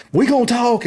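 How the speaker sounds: high, loud mock shout; retold angry yell. Yelling at his wife through the window, a retold shout. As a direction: bark it at the car window. Acted road rage quarrel.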